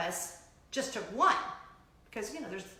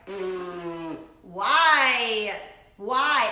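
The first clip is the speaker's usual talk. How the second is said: exasperated; 11 dB louder, much slower than usual. Retold or imitated. Mocking reaction to sequels.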